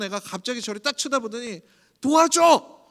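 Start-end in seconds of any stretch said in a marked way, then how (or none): 2.0-2.8 put-on high, loud shout of a little girl; child shouting for help. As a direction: yell it like a stuck toddler. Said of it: "이렇게 소리를 지르는데".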